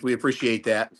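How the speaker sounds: ordinary delivery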